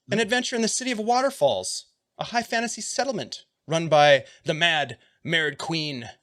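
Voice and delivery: animated ad read; promotional read. Acted. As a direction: sell the adventure like a trailer. Reads sponsor's adventure blurb aloud.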